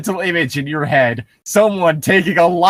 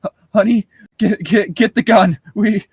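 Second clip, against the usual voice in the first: panicked stammer; 7 semitones higher than usual. Acted. Mother waking husband in panic.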